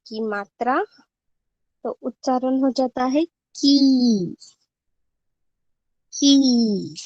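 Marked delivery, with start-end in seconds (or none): 3.2-4.4 की with the ee stretched long; long drawn-out vowel. Shown as a demonstration. Teacher demonstrates क with long ई, drawn-out की. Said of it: "लंबा खींचा जाता है". 6.1-6.9 की with a long, falling ee; long drawn-out vowel. Shown as a demonstration. Teacher repeats the syllable for students, drawn-out की. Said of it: "लंबा खींचा जाता है".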